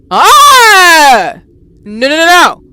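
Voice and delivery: loud high cry of no; frightened yell. Real, not acted. Another jump scare.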